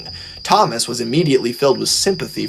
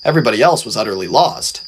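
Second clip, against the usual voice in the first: ordinary delivery, nothing marked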